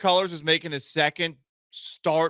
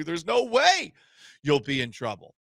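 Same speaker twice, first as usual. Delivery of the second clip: ordinary delivery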